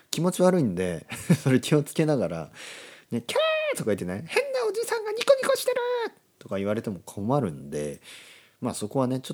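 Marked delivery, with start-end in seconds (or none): 3.3-3.8 high-pitched squealed scream; comic squeal of alarm; mock shriek. Acted frightened reaction to him. 4.3-5.8 very high, fast falsetto squeal; hammed-up shrieky cry for help; mock alarm. Alarmed onlooker pointing him out.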